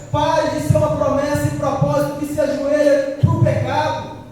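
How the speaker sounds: loud, forceful warning from the pulpit; fiery preaching. Acted. Preacher warning his brothers about sin, loud and forceful. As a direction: preach it hard at the congregation. Urgent call to stop sinning.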